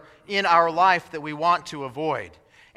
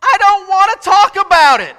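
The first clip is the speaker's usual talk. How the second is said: high squeaky mock voice, much louder; 13 semitones higher, 12 dB louder than usual. Preacher imitating a terrified climber in a squeaky voice. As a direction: squeak it out like a scared man. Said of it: "he said in a really squeaky voice".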